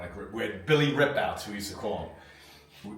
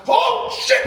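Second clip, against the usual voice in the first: much higher, much louder panicked curse; alarmed shout; 8 dB louder than usual. Retold or imitated. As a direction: yell it the moment you see the blood. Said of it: "I went".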